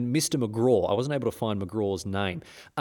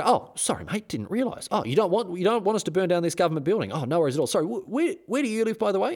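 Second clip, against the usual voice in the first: mock-apologetic soldiers, high and chirpy; 7 semitones higher, faster than usual. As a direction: play the soldiers as falsely sorry and breezy. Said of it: "And they go".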